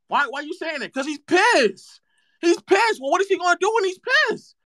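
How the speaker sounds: high, fast shouting hype; mock hype shout. Streamer shouts mock hype at chat, high and fast. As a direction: yell the question up, fast and high, to rile up chat.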